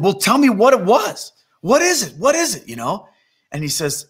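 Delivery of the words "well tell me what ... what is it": high-pitched, eager begging; acted youngster pressing for an answer; insistent pleading. Boy begs pastor to reveal the vision, insistent pleading.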